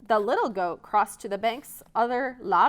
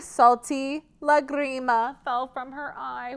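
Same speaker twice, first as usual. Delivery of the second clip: plain reading voice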